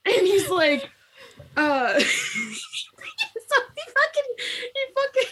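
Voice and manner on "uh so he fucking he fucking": high, slow, broken by laughter; can't get it out for laughing. Real, not acted. Retelling the plot, laughing too hard to finish. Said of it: "laughing while retelling".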